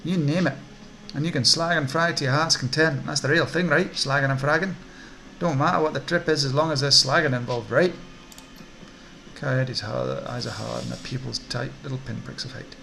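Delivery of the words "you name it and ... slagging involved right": loud, swooping Scottish hawker; Scottish accent. Acted. Twitchy orc dealer selling chip trips, sing-song Scottish accent. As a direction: sell it hard with a Scottish lilt. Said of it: "Don't know why he's Scottish".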